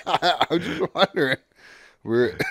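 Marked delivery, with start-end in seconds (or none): 0.1-1.4 laughing. Real, not acted. Laughs at own question.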